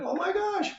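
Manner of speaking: very high, soft squeal of mock amazement; mock excited. Lecturer plays someone amazed by a talking cow, mock excited. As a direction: gush in a tiny, sky-high voice. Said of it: "you get all excited".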